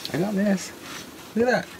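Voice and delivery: high, thrilled show-off squeal; excited squeal. Real, not acted. Vlogger shows viewers her souvenir, squealing excitedly. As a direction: show off the find with delight.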